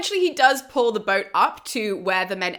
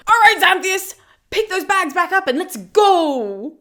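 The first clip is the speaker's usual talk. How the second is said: Dionysus voice; 9 semitones higher, 6 dB louder than usual. Retold or imitated. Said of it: "Dionysus turns around and he's like".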